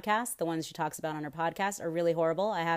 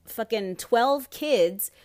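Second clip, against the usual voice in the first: slow, loud and higher, every word hit hard; outraged emphasis; 5 semitones higher, 6 dB louder, much slower than usual. Real, not acted. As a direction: spit out the number in disbelief. Ranting about abusive parents.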